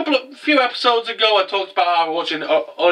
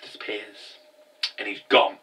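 usual speaking voice

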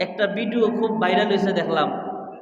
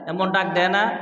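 normal talking voice

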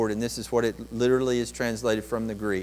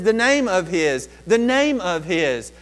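"name" hit hard both times, the second slower; stress on name; 7 semitones higher, 9 dB louder than usual. Shown as a demonstration. Pastor repeating Peter's phrase for emphasis, stressing "name". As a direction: lean on "name" in "The name of his". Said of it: "put emphasis on the name of his".

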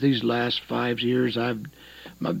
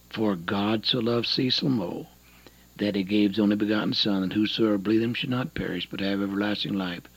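low, soft Bible verse; hushed, low scripture quoting; scripture recitation; 5 semitones lower, 4 dB quieter, faster than usual. Real, not acted. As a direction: recite the verse quietly and reverently.

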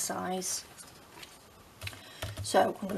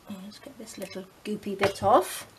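whispering. Real, not acted. Quiet aside while gluing.

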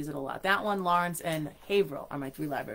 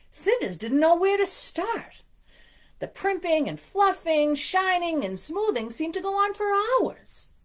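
higher, anxious storybook voice; worried narration; 10 semitones higher, 6 dB louder than usual. A narrator's reading. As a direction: read it with growing worry. Reads picture book aloud.

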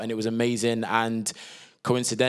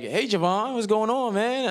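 director's breezy greeting; 10 semitones higher than usual. Retold or imitated. Director hails the auditioning actor on Skype, breezy imitated greeting. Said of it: "he's like".

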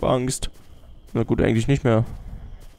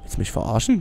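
ordinary talk, nothing marked

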